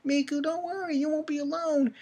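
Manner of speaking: high, soft reassuring girl, lightly voiced; comforting girl voice. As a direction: coax a scared child, pitched well up. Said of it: "comforting Miku".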